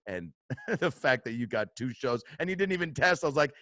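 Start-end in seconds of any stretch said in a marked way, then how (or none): none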